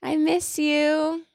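high, slow, drawn-out sweetness; affectionate. Real, not acted. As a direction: coo it to a missed friend. Sweet words to absent friend.

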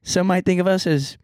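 bright intro announcer read; podcast host intro. Acted.

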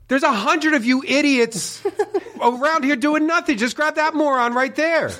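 high, laughing rant at the agents; hammed-up fury, cracking up; mock angry yell. Retelling yelling at airport staff, laughing angry rant.

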